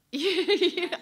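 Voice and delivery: bright burst of laughter; real amused laugh. Real, not acted. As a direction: laugh out loud at the thought. Laughs at eyelashes point.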